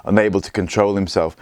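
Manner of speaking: plain reading voice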